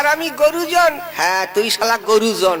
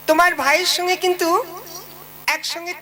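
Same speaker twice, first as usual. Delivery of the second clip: higher, quick nagging wife voice; a comic bride's higher, fast complaint; 6 semitones higher than usual. Acted. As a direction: play the demanding wife laying down the law.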